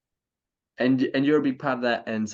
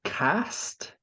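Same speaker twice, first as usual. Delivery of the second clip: lightly mocking posh Australian accent, pitched up; 7 semitones higher, 5 dB quieter than usual. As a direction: put on a bit of posh Australian. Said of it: "Was that [name] with his very posh Australian accent?".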